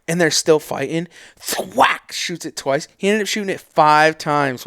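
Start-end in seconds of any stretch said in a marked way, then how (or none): 1.4-2.1 imitated arrow thwack. Imitating arrow hitting moose.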